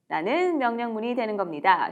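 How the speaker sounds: ordinary talk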